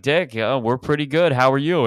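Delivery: hammed-up chummy reply; mock excited greeting. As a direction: gush back at a celebrity. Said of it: "And they're like".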